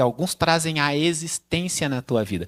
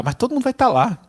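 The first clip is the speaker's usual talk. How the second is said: put-on pleading kid; 6 semitones higher, 3 dB louder than usual. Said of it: "E aí você fala".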